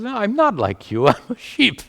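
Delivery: a silly lion protesting that he's really a sheep; confused lion. Acted. Confused lion insists it's a sheep.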